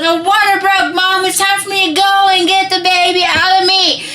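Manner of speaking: high, loud, urgent news; excited announcing. Retold or imitated. Daughter telling mom it's time, excited and loud. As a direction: belt out the big news high.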